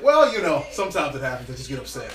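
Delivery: a hint of sheepish office voice.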